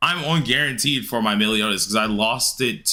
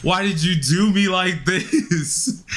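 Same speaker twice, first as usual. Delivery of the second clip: mock despair; 8 semitones higher, much slower than usual. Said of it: "I was like".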